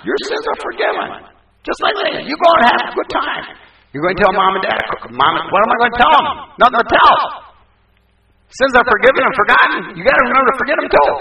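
high, loud, fast and joyful; joyful absolution. Retold or imitated. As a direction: burst out gladly with good news. Pastor joyfully absolves girl.

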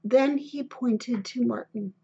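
ordinary delivery, nothing marked

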